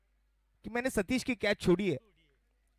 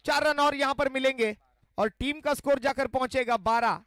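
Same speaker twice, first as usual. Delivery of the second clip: excited cricket commentary; 5 semitones higher, 4 dB louder than usual. Real, not acted. Boundary hit, live call.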